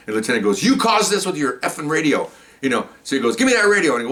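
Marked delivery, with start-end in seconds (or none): 0.6-2.3 raised-pitch irate boss, imitated; acted angry scolding. Retold lieutenant berating the detective. 3.3-4.0 high, irate imitated order; acted angry demand. Retold lieutenant angrily demanding the radio.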